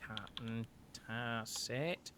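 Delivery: slow, soft repeat of a name; quiet echo. Acted. Innkeeper repeats the captain's name to note it, softly. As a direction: say it low, as if noting it down.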